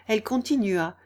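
usual speaking voice

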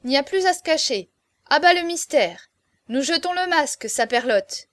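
loud, high, cocky proclamation; self-important. A narrator's reading. Jean-Marie throwing off all secrecy, loud and self-important. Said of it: "d'un air suffisant".

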